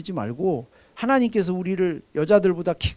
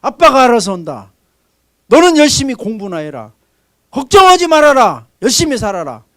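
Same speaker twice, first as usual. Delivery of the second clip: louder firm father's commands; bold fatherly assurance; 4 semitones higher, 9 dB louder than usual. Retold or imitated. Father encouraging his children to study and not worry, loud. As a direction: reassure the kids with confident force. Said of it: "그게 아버지죠".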